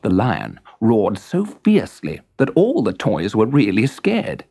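ordinary delivery

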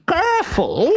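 very high squeal of warning; alarmed warning. A narrator's reading. Trunk occupant warns the man moving it, alarmed squeal.

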